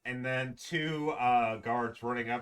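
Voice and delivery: normal talking voice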